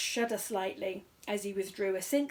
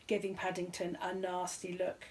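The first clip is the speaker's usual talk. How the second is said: ordinary delivery, nothing marked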